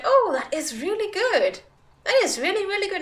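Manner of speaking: imitating mom. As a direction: play the delighted mother, bright and high. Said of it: "She was like".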